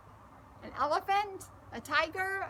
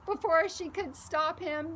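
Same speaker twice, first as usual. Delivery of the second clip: ordinary delivery, nothing marked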